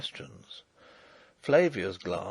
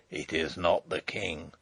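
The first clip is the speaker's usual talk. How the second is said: lower, hoarse and hushed; low hoarse whisper; 7 semitones lower, 4 dB quieter than usual. Acted. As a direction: rasp it out under your breath. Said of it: "spoke in a low, hoarse whisper".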